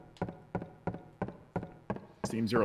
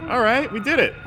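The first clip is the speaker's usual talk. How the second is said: excited; 10 semitones higher, 6 dB louder than usual. Real, not acted. Puzzle solved, cheering.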